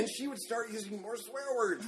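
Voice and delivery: ordinary talk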